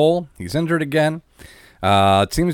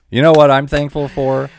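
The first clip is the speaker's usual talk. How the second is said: ordinary delivery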